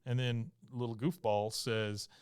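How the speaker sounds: normal talking voice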